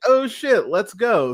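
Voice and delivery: mock excited "oh shit" up high; excited reaction. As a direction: cheer like a hyped fan. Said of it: "I was like".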